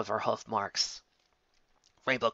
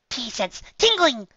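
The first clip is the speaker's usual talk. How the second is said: high, slow, hammed-up sing-song; silly Pinkie; 12 semitones higher, 7 dB louder, slower than usual. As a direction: announce it like a goofy superpower.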